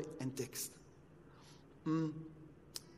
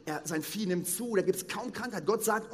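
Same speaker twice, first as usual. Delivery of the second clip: ordinary talk, nothing marked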